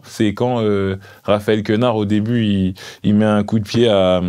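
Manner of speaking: ordinary delivery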